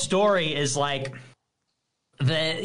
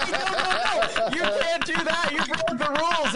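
mock whiny scold; 7 semitones higher than usual. Mocking whiny rule enforcer.

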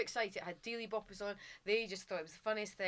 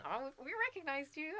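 Kermit voice; 8 semitones higher than usual. Retold or imitated. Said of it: "He's going".

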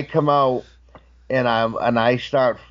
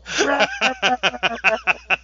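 high rattling laughter; real laugh; 8 semitones higher than usual. Real, not acted. Storyteller amused by his own retold fight, laughing hard. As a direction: crack up after the impression. Cracks up after acted fight.

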